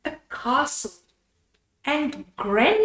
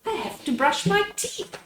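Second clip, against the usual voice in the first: put-on high child voice; 7 semitones higher, faster than usual. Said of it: "Anna says".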